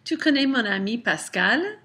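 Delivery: "Pascal" rising at the end as a question; rising intonation. Shown as a demonstration. Teacher shows a statement made into a question, rising intonation. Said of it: "My voice rises at the end".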